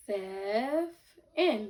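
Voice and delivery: "Sev" held, pause, then "in"; two separate syllables. Shown as a demonstration. Teacher checking pupils hear the short E, syllable by syllable. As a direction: break it cleanly into "Sev" and "in". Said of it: "break down seven into two syllables".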